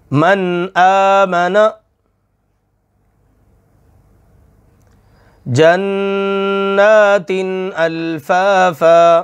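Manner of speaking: very slow chanted verse; teacher recites the practice words; slow Quranic recitation. Shown as a demonstration. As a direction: draw each word out in recitation. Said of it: "तो कैसे पढ़ेंगे".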